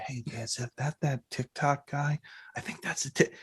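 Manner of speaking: hushed whisper to a companion; soft gossiping whisper. Retold or imitated. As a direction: whisper it behind their back. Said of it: "you hear whispers".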